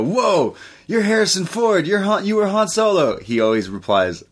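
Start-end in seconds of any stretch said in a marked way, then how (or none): 0.0-3.2 high, gushing fan voice; starstruck fan. Retold or imitated. Fans recognizing Harrison Ford, high starstruck gush. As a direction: gush like a fan meeting his idol. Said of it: "whenever people go".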